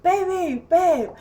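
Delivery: high-pitched urgent calling out; retold yelling. Locked-out woman banging for partner, retold high yelling. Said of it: "I'm yelling and I'm banging".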